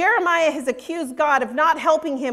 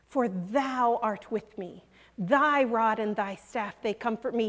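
intimate address; 5 semitones lower, 6 dB quieter than usual. Shown as a demonstration. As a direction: say "For thou art with me" gently and quietly, as if to a friend beside you. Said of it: "no longer speaking of him, but to him".

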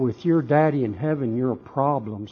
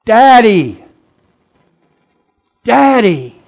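high, anguished shout to a father; crying out; 9 semitones higher, 14 dB louder, slower than usual. Acted. Jesus cries to his Father in agony, loud anguished cry. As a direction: cry out for Daddy at full voice. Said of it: "he cries out".

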